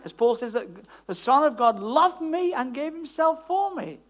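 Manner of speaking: loud, forceful sermon delivery; emphatic preaching. Real, not acted. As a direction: drive the doctrine home to the congregation.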